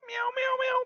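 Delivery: high meows from the cat puppet; cat puppet meowing. Pretend. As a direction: meow like a playful cat puppet. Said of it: "So watch here".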